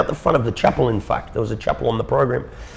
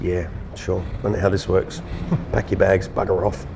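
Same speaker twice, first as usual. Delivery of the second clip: resigned, low; 4 semitones lower, 4 dB quieter than usual. Retold or imitated. Man expecting expulsion answers the boss's wife, resigned and low. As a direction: lower and softer, a wry laugh, giving up. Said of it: "I said".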